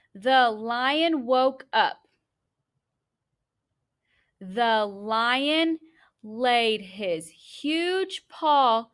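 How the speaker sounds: a teacher modeling fluent reading; fluent read-aloud. Shown as a demonstration. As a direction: read it slowly aloud to the class, pausing at each comma.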